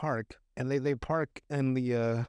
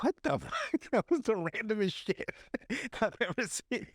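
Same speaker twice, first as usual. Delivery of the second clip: high-pitched, breaking up with laughter; incredulous words through real laughter; laughing in disbelief; 7 semitones higher than usual. Real, not acted. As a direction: crack up while getting the words out. Cracks up at random scene.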